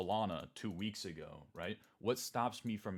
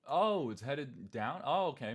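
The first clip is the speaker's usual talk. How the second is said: slow, higher, louder fake amazement; mock surprise; 5 semitones higher, 6 dB louder, slower than usual. Teaching viewers the downtrend, with hammed-up surprise. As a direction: drag it out like a child noticing. Mock naive chart reading.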